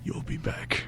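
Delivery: hushed and menacing, letting it linger; creepy whisper. Retold or imitated. Texting back the scam bot, a creepy parting whisper.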